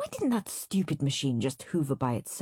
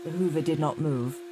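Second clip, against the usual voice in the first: plain reading voice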